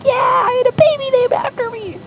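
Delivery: very high, bright falsetto gushing; excited squeal. Acted. Zip bragging the baby is named after him, high squeal. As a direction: gush like an overjoyed child.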